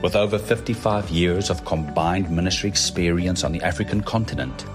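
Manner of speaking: normal talking voice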